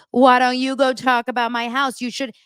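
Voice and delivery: a touch of a snippy challenge; mock Angie. Recapper imitating Angie confronting Meredith at dinner. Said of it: "Angie's like".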